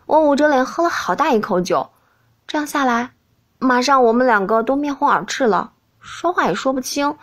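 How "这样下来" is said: usual speaking voice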